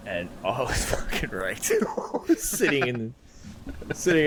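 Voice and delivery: giggling through the words; breaking up laughing while talking; wheezing with laughter. Real, not acted. Laughing while telling drunk story.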